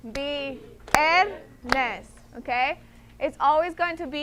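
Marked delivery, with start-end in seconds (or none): 0.1-2.1 "Viernes" split into syllables with a clap on each; clapped syllables. Shown as a demonstration. Teacher clapping the word out with class, syllable by syllable. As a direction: clap out "vier-nes" with weight on "vier". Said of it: "let's split it, clap with me".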